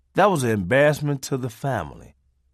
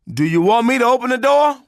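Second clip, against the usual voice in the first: eager call; 10 semitones higher, 6 dB louder than usual. Retold or imitated. Kid calling.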